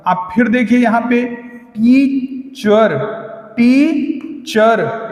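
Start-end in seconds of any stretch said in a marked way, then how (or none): 1.8-3.2 teacher split slowly as टी, चर; syllable by syllable. Shown as a demonstration. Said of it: "यहाँ पर कितने हैं?". 3.6-5.1 teacher split slowly as टी, चर; syllable by syllable. Shown as a demonstration. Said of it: "दो हैं।".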